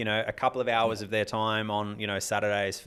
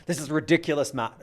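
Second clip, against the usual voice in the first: put-on a weak, half-hearted Scottish accent; 5 semitones higher than usual. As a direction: mimic a regular in a Scottish accent. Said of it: "I'm trying to do a Scottish accent".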